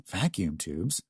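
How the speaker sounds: softly spoken private thought; idle musing. A narrator's reading. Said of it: "Scott thought idly".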